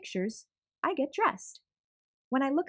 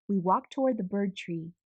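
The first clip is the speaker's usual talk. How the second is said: ordinary delivery, nothing marked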